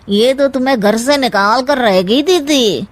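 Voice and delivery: ordinary talk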